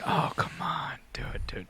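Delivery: hushed whisper urging; whispered egging on. Retold or imitated. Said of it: "I was like".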